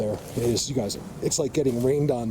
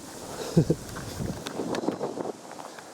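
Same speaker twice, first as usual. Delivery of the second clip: soft, voiceless chuckle; drawn-out, breathy little laugh; 5 semitones higher, 5 dB quieter than usual. Real, not acted. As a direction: laugh quietly to yourself. Speaker chuckles after making point.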